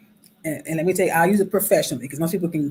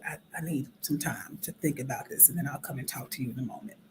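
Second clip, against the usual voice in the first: whispered; 4 semitones lower, 12 dB quieter than usual. Retold or imitated. Quoting healthy way to step away.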